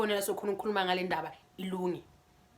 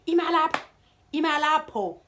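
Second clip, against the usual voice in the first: high, louder call; a raised, high call repeated; 8 semitones higher, 6 dB louder than usual. Retold or imitated. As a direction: shout after her on the street. Calling out after her.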